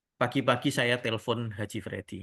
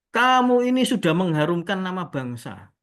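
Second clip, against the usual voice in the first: raised, loud mock praise; changed, admiring tone; 5 semitones higher, 7 dB louder than usual. Haji praising the supposed champion, imitated grand admiration. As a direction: imitate a client proclaiming national glory. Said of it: "Nadanya beda".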